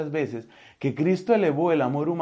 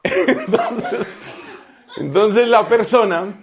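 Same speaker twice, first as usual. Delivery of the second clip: louder, laughing through the words; 6 semitones higher, 9 dB louder than usual. Real, not acted. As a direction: speak while still laughing. Laughing after the imitation. (riendo, más fuerte)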